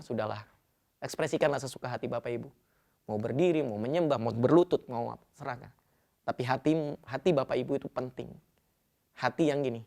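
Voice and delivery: ordinary delivery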